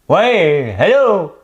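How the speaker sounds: high, loud hammed-up phone greeting; high mock greeting. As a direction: wave hello at a phone too close. Imitating Plante's live videos.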